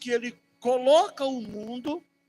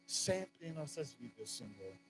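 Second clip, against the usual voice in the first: fervent prayer; 5 semitones lower than usual. Acted. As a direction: pray humbly to God on behalf of the church. Leads a prayer over music. (oração fervorosa e íntima)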